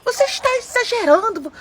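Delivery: put-on husband shouting at his wife at the baby shower; retold shouting. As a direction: yell at her to stop, then ease off. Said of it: "o meu marido começou a gritar".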